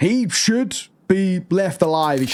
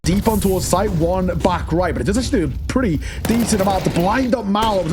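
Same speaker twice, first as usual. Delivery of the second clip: ordinary talk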